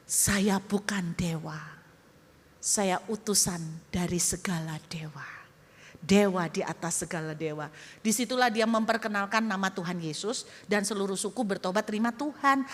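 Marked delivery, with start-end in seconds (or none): 0.1-7.7 hushed solemn reply. Acted. Young man reveals himself solemnly.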